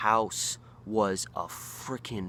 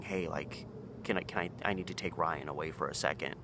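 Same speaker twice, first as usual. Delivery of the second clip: hushed request; 4 semitones lower, 6 dB quieter than usual. Retold or imitated. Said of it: "kind of quietly knocks on it".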